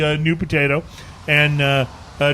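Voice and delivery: ordinary delivery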